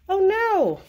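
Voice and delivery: sudden high, loud dismay; surprised oh no. Real, not acted. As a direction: yelp it as the paper tears. Craft piece rips mid-demo.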